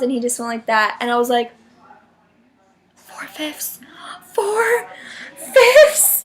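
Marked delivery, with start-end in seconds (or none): 3.0-3.8 breathless awed whisper. Retold or imitated. Whispered disbelief. 4.3-6.2 rising squeal. Retold or imitated.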